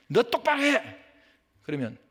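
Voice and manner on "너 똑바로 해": high, loud scolding jab; sharp reprimand. Acted. Someone criticizing a believer to their face, sharp reprimand. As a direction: act out a stern telling-off.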